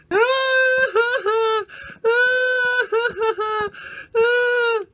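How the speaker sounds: hammed-up crying; theatrical bawl. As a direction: wail over the fish. Cries on the fish.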